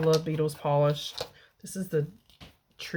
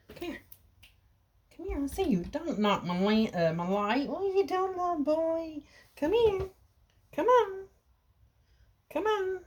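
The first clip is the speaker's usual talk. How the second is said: much higher, sing-song pet talk; 11 semitones higher than usual. Real, not acted. Owner coaxing her cat Pedro closer, high baby talk.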